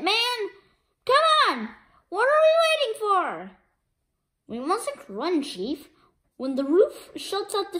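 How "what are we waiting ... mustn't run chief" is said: ordinary delivery, nothing marked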